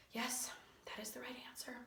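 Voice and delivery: hushed, voiceless admission; defeated near-whisper. Acted. Teacher gives in to pupil's odd counting, defeated whisper. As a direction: give in under your breath.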